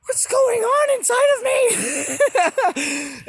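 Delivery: very high, drawn-out mock wail; bewildered shout. Man crying out at voices inside him, bewildered shout. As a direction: shout it high, loud and slow in comic alarm. Acting out his bewildered cry.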